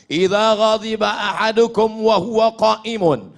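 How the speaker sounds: usual speaking voice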